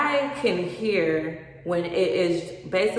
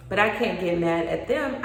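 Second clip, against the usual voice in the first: usual speaking voice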